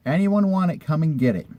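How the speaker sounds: high-pitched start reading the ad aloud; quoting the ad. Retold or imitated. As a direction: read the ad with a lift. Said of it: "That's how the ad said".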